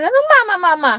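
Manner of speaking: high-pitched mocking repeat; mock the word back, high and sharp; mocking high scold. Mother mocks back.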